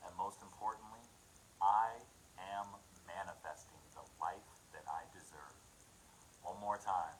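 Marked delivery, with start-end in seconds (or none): 1.6-5.6 punched "I", then an even, unforced finish; measured affirmation. Real, not acted. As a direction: affirm it steadily with a pause after "I". First take, before chest command.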